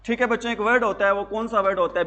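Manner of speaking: usual speaking voice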